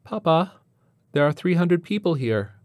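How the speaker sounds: put-on high little-boy voice; small boy interrupting. A narrator's reading. Four-year-old interrupts his father's conversation, piping up. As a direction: pipe up to get a father's attention. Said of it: "He attempts to interrupt his father's conversation".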